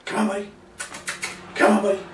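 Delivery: bright high inviting call to the dog; high coaxing call. Shown as a demonstration. Trainer inviting dog to jump up, high coaxing. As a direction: coax the dog up, pitched high. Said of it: "I say".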